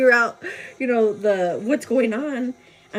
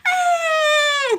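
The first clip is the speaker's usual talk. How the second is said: sudden high wailing baby cry, mimicked; imitated baby cry; 4 dB louder than usual. Telling friends how babies cry, imitates a high wail. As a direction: mimic a baby's breathless cry. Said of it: "They're like".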